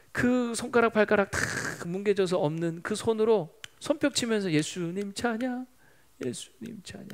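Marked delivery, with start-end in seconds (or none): 4.5-7.1 gentle praise. Retold or imitated. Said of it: "찬양하면서 앉아있을 것 같더라는". (나직이 찬양하듯)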